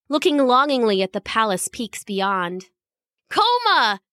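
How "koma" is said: excited shout. A narrator's reading. Goen spotting Koma by the wall, excited shout. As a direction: call out to a long-sought friend. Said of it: "he shouted".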